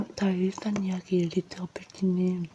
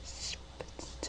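cursing under breath; 14 dB quieter than usual. Real, not acted. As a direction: swear in a breathy hush. Muttered swear after outburst. (작게 내뱉는 욕)

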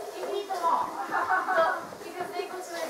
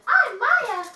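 pretend girl voice; 7 semitones higher, 8 dB louder than usual. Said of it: "and he could be like".

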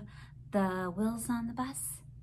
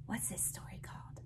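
whisper; 16 dB quieter, faster than usual. Acted. Whispering the story title.

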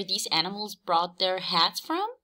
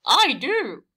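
high, louder eager Horse; eager cry; 6 semitones higher, 5 dB louder than usual. A narrator's reading. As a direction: jump in keenly as Horse. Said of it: "cried Horse".

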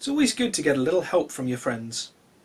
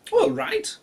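high cat voice; 3 dB louder than usual. Acted.

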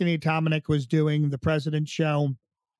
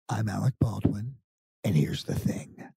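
hushed low podcast-host intro; Alec Baldwin impression; 7 semitones lower, 4 dB quieter than usual. Trump impersonator doing Alec Baldwin's podcast voice for the host. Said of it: "I do know how to say, um, as him".

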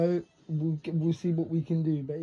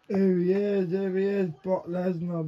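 ordinary talk, nothing marked

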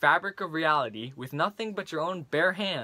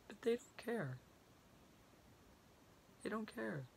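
much softer, broken and hushed; defeated, quiet; 9 dB quieter than usual. Acted. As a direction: sink into weary despair. Rant collapses into resignation.

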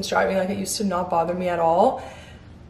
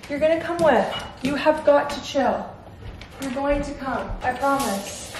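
higher-pitched, soothing dog talk; high coaxing pet voice; reassuring a pet; 6 semitones higher, 3 dB quieter than usual. Real, not acted. Reassuring her frantic dog.

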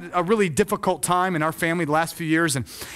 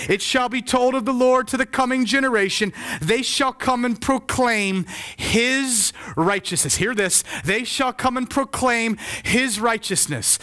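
high, ringing scripture declaration; raised, stately preacher's reading; proclaiming scripture; 5 semitones higher, slower than usual. Real, not acted. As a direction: draw out each key word for the congregation.

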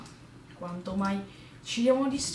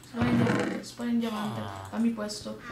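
ordinary talk

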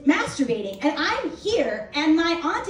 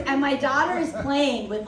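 normal talking voice

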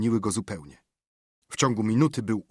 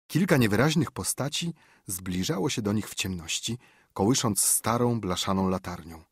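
ordinary delivery, nothing marked

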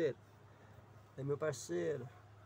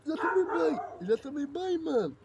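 high, loud protest; raised voice, fending off a dog; 8 semitones higher, 8 dB louder than usual. Real, not acted. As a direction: shout it at an over-eager pet.

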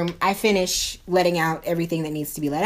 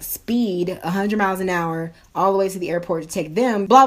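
normal talking voice

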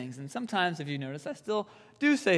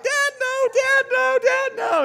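high-pitched, loud pleading wail; hammed-up childish crying, begging for mercy; mock crying plea; 9 dB louder than usual. Speaker acts his young self begging dad off hot sauce, mock crying. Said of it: "And obviously I'm crying".

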